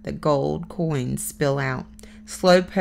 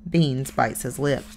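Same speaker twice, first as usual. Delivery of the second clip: ordinary delivery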